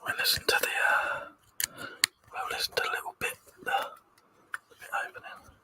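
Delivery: soft breathy whisper into the mic; hushed ASMR whisper for the stream chat. Pretend. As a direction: whisper like you're lulling viewers to sleep. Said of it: "Would you like some A S M R?".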